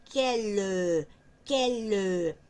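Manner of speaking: "quel, que le" slow with a strong joined sound; linked liaison demo. Shown as a demonstration. Teacher shows students the strong linked sound of "quel, que le". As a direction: stress the link in "quel, que le" slowly. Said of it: "Es un sonido más fuerte".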